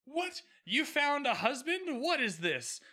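high, disbelieving squeak of surprise; shocked Hamilton. Retold or imitated. Hamilton hearing Angelica found a husband, shocked. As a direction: play Hamilton caught off guard. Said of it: "Hamilton is like".

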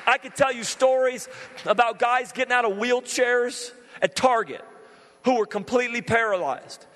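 high, loud revival preaching; climbing evangelist fervor; fired-up preaching. Acted. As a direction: preach it out full force to the crowd. Preaching at full force.

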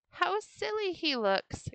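put-on high, drawn-out children's cry; crying out mockingly. A narrator's reading. Children mocking the turtle as he passes, high mocking cry. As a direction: call it out slowly in high-pitched ridicule. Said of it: "cried the children".

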